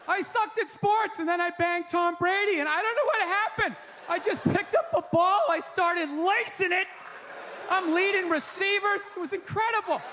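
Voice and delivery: a hint of a ditzy spouse bragging about football; mock wife voice. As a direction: play her as a clueless bragging wife. Tom Brady's wife speaking.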